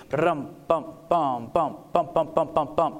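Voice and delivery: vocal drum march imitation; drum cadence mouth sounds. Shown as a demonstration. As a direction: beat out a march with your mouth. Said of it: "a drum march, a cadence".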